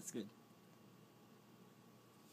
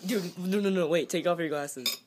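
excited; 4 semitones higher, 7 dB louder than usual. Real, not acted. Friend's eyes after wasabi.

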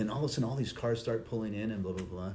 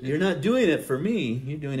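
laughing retort; 5 semitones higher, 6 dB louder than usual. Retold or imitated. Mock reply to the complainer.

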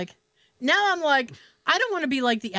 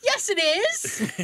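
high, drawn-out, fake-cheery reply; exaggerated sing-song phone politeness; overly jovial; 8 semitones higher, much slower than usual. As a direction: lay on the joviality thickly. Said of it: "I have to be overly jovial".

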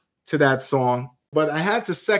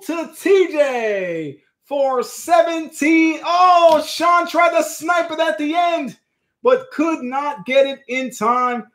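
excited play-by-play; 7 semitones higher, slower than usual. Acted. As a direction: call it like a live sports moment. Announcing winning bid.

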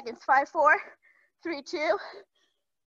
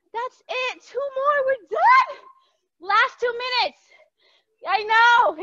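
excited cheering; 7 semitones higher, 5 dB louder, slower than usual. Real, not acted. Coach pushing class at the end.

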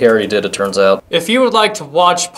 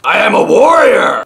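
loud, raised warrior boast; mock battle cry; 4 semitones higher, 6 dB louder than usual. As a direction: shout it like a pretend warrior.

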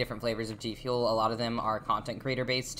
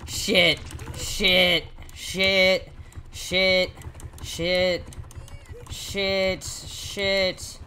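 louder, higher repeated panicked curse; panicked cursing; 6 semitones higher, 6 dB louder, much slower than usual. Real, not acted. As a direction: spit out each curse in a panic. Chased by killer.

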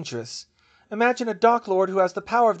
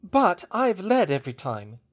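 firm, raised rebuttal; countering; 6 semitones higher, 7 dB louder than usual. A narrator's reading. Hermione answering her friend's doubt, countering firmly. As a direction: counter the objection, higher and louder. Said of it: "she countered".

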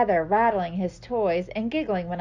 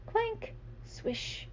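sound effect voice; 10 dB quieter than usual. Acted. Imitating household sounds.

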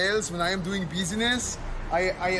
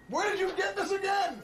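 shouting; 12 semitones higher, 3 dB quieter than usual. Acted. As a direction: yell it across the room. Shouting at someone.

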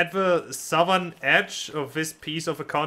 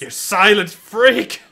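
mock angry shout; 3 semitones higher, 9 dB louder, slower than usual. Yelling at game mime.

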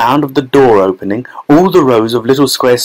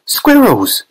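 excited shout; 10 semitones higher than usual. A narrator's reading. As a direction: cry out in a high voice at the sight. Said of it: "shouted Veruca Salt".

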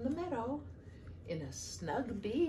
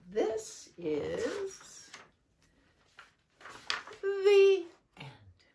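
high, loud, drawn-out storybook ending; grand finale; 13 semitones higher, 7 dB louder, much slower than usual. A narrator's reading. Reading to children, closing the book with a big finish. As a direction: land the last words with a big flourish. Dramatic close of the picture book.

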